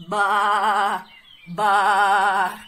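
low, loud bleating; low loud sheep baa. Acted.

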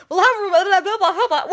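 high, loud showy greeting; hammy intro voice. Acted. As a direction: go big on the welcome. Intro voice then laughs.

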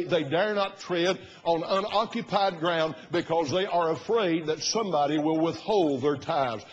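normal talking voice

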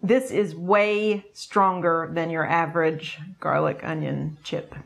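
ordinary talk

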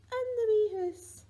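high, loud playful squeal on the rhyme's last line; excited squeal. Pretend. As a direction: burst up into a delighted squeal as the tickle lands. Rhyme climax, tickling baby.

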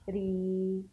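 ري with a long, held ee; lengthened vowel. Shown as a demonstration. Teacher showing pupils how ya lengthens the letter, drawn-out ري. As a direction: stretch the ya sound in ري. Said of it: "نمد الحرف على حسبها".

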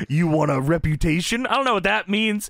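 hammed-up wrestler promo, rising at the catchphrase; Macho Man. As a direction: imitate a showboating wrestling star. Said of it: "He says".

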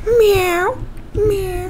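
high, soft mock meows; imitating cat meow. Gamer mocking the mannequin as a cat, high meows. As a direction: mew like a cat, high and quiet. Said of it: "I'm a cat".

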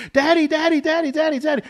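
high, loud imitated child screaming for daddy; little girl screaming. Father imitating his scared daughter's scream. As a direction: shriek it like a terrified four-year-old. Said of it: "screaming at the top of her lungs".

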